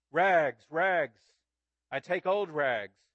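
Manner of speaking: loud street cry, slowing at the end; the bleeding ragman crying his wares. Acted. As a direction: call it out through the hurt. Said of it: "cried the sobbing".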